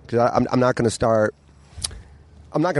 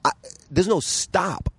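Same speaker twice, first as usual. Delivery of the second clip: a touch of drawn-out despair; 8 semitones higher, slower than usual. Retold or imitated. Replaying what he told his friends.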